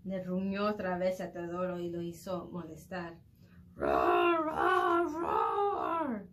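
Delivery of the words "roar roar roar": put-on loud kid roaring; playful shouted roars; repeated roars. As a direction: roar three times like a pretend monster. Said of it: "He roared again".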